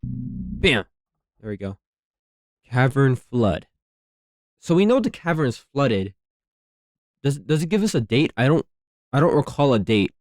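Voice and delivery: normal talking voice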